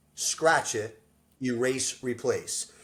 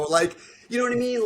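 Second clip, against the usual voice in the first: chuckling while talking, higher and quick; laughing; 11 semitones higher, 4 dB louder, faster than usual. Real, not acted. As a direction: laugh through the line, fast. Laughing after the punchline.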